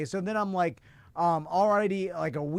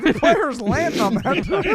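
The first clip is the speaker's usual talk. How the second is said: mock horrified fan; 7 semitones higher, 6 dB louder, slower than usual. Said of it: "And I'm like".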